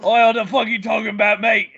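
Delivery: hammed-up British challenge; mock British accent. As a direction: put on a rowdy British "mate" voice. Mock British retort to co-host.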